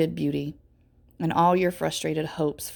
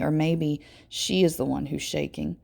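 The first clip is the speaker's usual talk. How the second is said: plain reading voice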